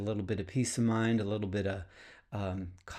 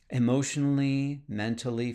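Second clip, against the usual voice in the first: ordinary talk, nothing marked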